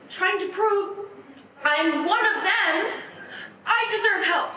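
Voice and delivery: high-pitched, insistent plea to be believed; desperate pleading. Acted. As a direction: beg them to accept you, pitch held high. Relives pleading with coworkers.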